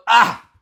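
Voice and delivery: a loud groaning "ah" of sympathy; heartfelt moan of empathy; pained sigh. Retold or imitated. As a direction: let out a drawn groan as if you feel the pain. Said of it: "you didn't go".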